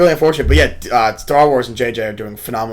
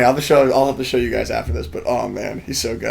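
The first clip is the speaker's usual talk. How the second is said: ordinary delivery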